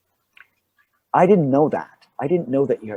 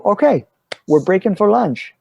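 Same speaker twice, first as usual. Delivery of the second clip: high, raised-voice crew announcement; calling out; 9 semitones higher, 5 dB louder than usual. Retold or imitated. Crew announces lunch to everyone on set, calling out. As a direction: imitate calling a break across the set.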